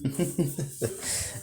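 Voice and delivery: a high, breathy burst of character laughter. Acted. Deku laughs at the dragon's outrage, a high laugh. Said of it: "As he starts laughing and says".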